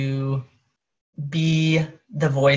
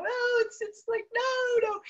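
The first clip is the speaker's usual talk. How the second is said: much higher, softer, mock-squeaky dismissal; squeaky brush-off; 5 dB quieter than usual. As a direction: squeak out a flustered no, no. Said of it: "You know".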